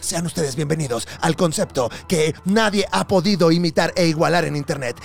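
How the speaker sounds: from trailer voice building to a sung, shouted hype; hype announcer. Acted. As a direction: announce the guest like a wrestling MC. Hype intro of the guest.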